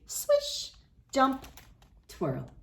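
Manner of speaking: swish. Acted. Dance steps read playfully.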